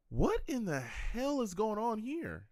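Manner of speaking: slow, drawn-out what in the hell; baffled disbelief. Real, not acted. Streamer baffled by fans dancing on screen, slow disbelief. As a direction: stretch it out in total confusion. Reacting to strange broadcast footage.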